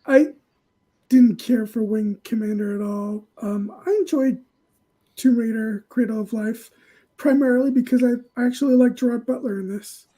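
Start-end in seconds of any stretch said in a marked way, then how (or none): none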